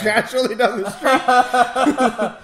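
high, loud and slow with laughter; laughing. Real, not acted. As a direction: laugh through it in disbelief. Laughing at the scene.

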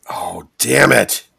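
slow, loud exasperated groan; real exasperation at being stuck; frustrated curse. Real, not acted. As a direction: curse yourself, drawn out. Stuck on the answer.

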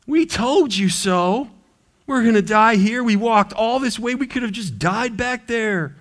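high mock-whining told-you-so; whiny complaining Israelites. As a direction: nag like a grumbling crowd. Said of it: "that's a great speech".

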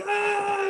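high, drawn-out mock scream; imitated scream. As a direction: let out a long re-enacted yell. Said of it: "And I'm screaming".

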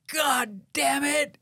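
slow, high, far-off howl; pained yell. Retold or imitated. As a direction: cry out as the hook sinks in. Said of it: "and I hear".

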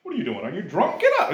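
high, incredulous priest; mock scolding priest. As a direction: bark at her like a clueless priest. Imitating priest scolding Hannah.

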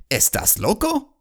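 high-pitched question in Spanish; Spanish-speaking worker. Acted. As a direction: call up incredulously in Spanish, playfully acted. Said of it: "some other guy says".

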